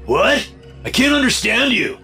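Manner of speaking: high, strained angry captor; rising anger. A narrator's reading. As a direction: pitch jumps up as he challenges her. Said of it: "voice growing louder with each word".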